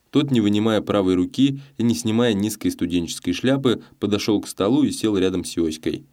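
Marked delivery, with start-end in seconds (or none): none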